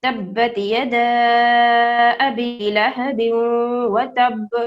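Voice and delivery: تبت یدا ابی لہب وتب recited slowly, stopping on وتب; slow tilawat with a silent end; slow recitation. Shown as a demonstration. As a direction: recite slowly and stop on the last letter. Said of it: "ایک بار میں پھر سے پڑھتی ہوں".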